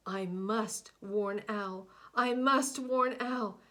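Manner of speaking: determined cry growing to a shout; rising resolve. Acted. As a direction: push the vow out harder. Building determination.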